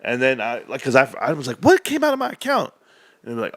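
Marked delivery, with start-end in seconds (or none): 1.6-2.7 high-pitched outraged disbelief; hammed-up alarmed customer; mock shock. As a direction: cry out at a surprise bill, pitched way up. Retold shock at a charge.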